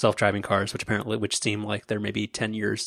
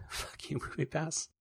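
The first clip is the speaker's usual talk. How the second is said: muttered curse; 5 semitones higher, 13 dB quieter than usual. Real, not acted. Grumbling curse at the company.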